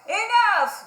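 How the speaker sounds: loud, high fed-up shout; the fed-up crowd cries enough; villagers shouting. Acted. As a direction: yell it as the angry villagers. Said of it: "they said".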